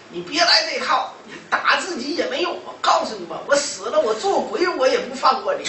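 high, loud voice of a vengeful daughter; acted-out retort, hammered home; defiant threat. Daughter's threat to mother, retold.

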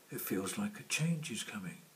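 usual speaking voice

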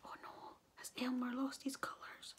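very soft, hushed elephant voice; worried whisper. A narrator's reading. Elephant fears for Elmer's colours, whispering. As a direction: whisper it as a fearful question. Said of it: "whispered the elephant".

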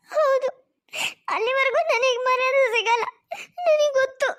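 wife crying to her husband, voice breaking; acted crying. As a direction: cry it out, hurt and helpless.

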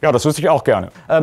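usual speaking voice

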